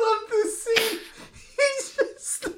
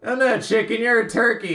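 much lower, flat comeback; deadpan low retort; 9 semitones lower, faster than usual. Retold or imitated. Boruto brushing off the bully in a low comeback. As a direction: drop the voice and shut it down. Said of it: "Boruto's like".

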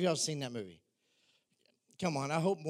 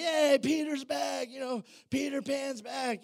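higher, slow cheer; cheering shout; 8 semitones higher, 3 dB louder than usual. Retold or imitated. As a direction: call out like kids welcoming a hero. Lost boys cheer his return.

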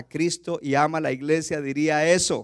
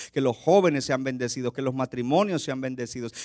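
ordinary talk, nothing marked

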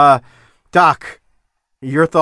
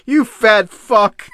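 mock disgusted wrestler; 6 semitones higher, 5 dB louder, much slower than usual. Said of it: "Tommy Rich even looks at him like".

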